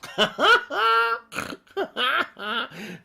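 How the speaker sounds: cackling, helpless laughter; a real laugh that breaks out; loud high laugh. Real, not acted. Laughs at a snort.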